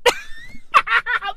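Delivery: high, wheezy giggling laugh; real high-pitched laughter. Real, not acted. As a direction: crack up helplessly at your own joke. Cracks up at own joke.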